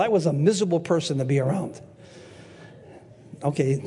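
normal talking voice

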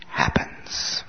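breathy whisper for effect; dropped to a whisper. Acted. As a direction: let the word fade into a whisper. Preacher dropping voice for emphasis.

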